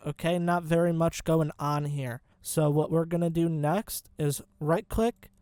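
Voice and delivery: ordinary delivery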